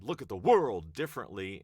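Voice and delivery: world punched loud and stretched; stress on world. Shown as a demonstration. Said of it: "where does it sound right for you".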